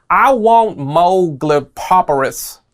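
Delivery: mock Klingon. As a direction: drag out the nonsense word like mock Klingon. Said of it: "said something in Klingon just now".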